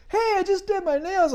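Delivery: high, put-upon lady's complaint; annoyed lady. Retold or imitated. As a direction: play the customer, voice up high and offended. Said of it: "And she's like".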